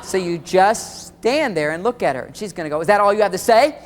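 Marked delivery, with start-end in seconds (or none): 2.8-3.8 loud, mock-indignant wife's challenge; indignant demand. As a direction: demand more from him, voice raised. Acted upset wife confronts late husband.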